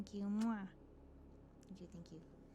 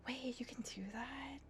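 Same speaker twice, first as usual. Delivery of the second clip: whispering; 8 semitones higher, 5 dB quieter than usual. Real, not acted. Surprised aside.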